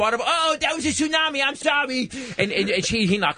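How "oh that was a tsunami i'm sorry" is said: exaggerated impaired speech, pitched very high; high, hammed-up fake apology; Mike. Friend pretending clumsiness to torment the waitress, exaggerated impaired voice. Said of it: "he cranks the level of reach on up three thousand percent".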